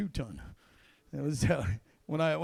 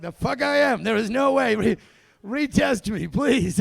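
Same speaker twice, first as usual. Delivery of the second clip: loud, higher, panicked disbelief; loud mock protest; 4 semitones higher, 8 dB louder than usual. Recruit begging tester after passed drug test, loud panic. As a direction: beg them to retest, loud and rattled.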